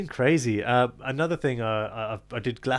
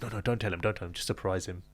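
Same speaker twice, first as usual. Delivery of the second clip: quiet, low imitation of a friend hushing someone; hushed interruption; 3 semitones lower, 9 dB quieter than usual. Friend stops him spoiling the surprise, hushed. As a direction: retell it softly, as if keeping a secret.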